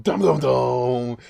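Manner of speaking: loud, slow suspense sting sung out; dramatic dum dum dum. Retold or imitated. Loud slow suspense sting while retelling the chair scene. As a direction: hum a loud, slow horror reveal.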